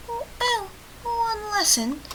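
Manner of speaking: high, slow mocking coo; mock pity. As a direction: draw it out high and teasing. Mocks having only one lesson.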